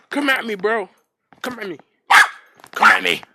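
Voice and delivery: loud playful taunt; mock tough dare to the dog; mock challenge. Real, not acted. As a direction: dare the dog to come at him. Daring the dog.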